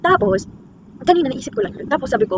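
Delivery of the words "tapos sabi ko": normal talking voice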